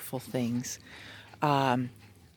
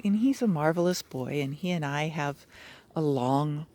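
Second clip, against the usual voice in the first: ordinary talk, nothing marked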